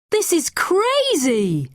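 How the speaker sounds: put-on boy exasperated by her claims. A narrator's reading. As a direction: throw up his hands at it.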